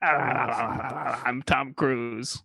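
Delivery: slow, drawn-out movie star voice; Tom Cruise impression. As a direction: do your best Tom Cruise. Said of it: "I can't do it".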